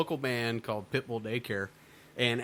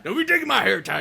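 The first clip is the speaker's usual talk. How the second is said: higher, louder mock yell at grannies; 8 semitones higher, 8 dB louder than usual. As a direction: snap at the old ladies. [name] yelling at old ladies.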